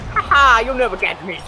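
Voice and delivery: high, loud mock gloating; taunting donkey. Acted Donkey taunting the knights.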